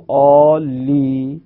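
عالی ending in a held long "ee"; long vowel. Shown as a demonstration. Teacher shows today's pronunciation, madd held on عالی. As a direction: stretch the final ee of عالی, no vowel after it.

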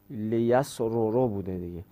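normal talking voice

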